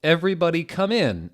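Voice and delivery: mock Japanese greeting. The goth teacher welcoming her class, in a lightly hammed-up greeting. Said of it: "said Professor Sinister in Japanese".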